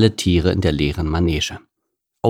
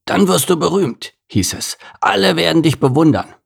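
higher mock quote of old promises; mocking grumble; 8 semitones higher than usual. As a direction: sneer at what they once told him. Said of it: "grummelte Gerhard".

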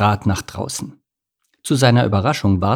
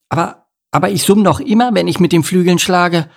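high, louder stammering mosquito; baffled; 8 semitones higher, 4 dB louder than usual. A narrator's reading. Mosquito defending her humming, baffled. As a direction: protest in puzzled surprise. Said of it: "meinte Stella verblüfft".

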